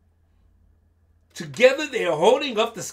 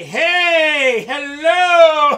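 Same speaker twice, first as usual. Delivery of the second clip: very loud, high, long-held holler; crowd greeting shout; 8 semitones higher, 13 dB louder, much slower than usual. Acted. All the animals arriving and greeting, shouting. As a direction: cry out like a whole herd arriving.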